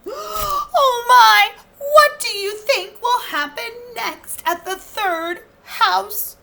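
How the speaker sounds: high, loud storyteller gasp; wide-eyed suspense for the kids; hammed-up excitement; excited. A narrator's reading. Narrator excites listening children.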